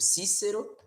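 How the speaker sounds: Cícero said with s sounds; Latin Cícero. Shown as a demonstration. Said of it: "é assim como em português".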